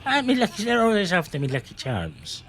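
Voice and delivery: hammy Irish leprechaun; high, singsong leprechaun brogue; Lucky Charms leprechaun. Retold or imitated. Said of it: "Or something like that".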